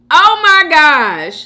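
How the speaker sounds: loud, high, slow drawn-out cry; amused exclamation. Real, not acted. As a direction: draw it out loudly, overcome with amusement. Still amused by the comment.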